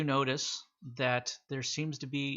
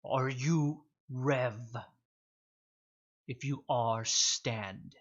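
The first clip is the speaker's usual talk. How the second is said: slow beast command; much slower than usual. Acted.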